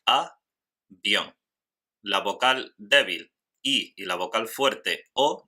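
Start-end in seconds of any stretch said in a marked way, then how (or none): 0.1-1.3 avión split as a... vión, first part raised, second falling; syllable by syllable. Shown as a demonstration. Teacher shows the diphthong in avión, syllable by syllable. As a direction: say a, pause, then vión as one syllable. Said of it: "tenemos dos sílabas".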